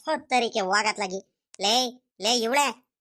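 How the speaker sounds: usual speaking voice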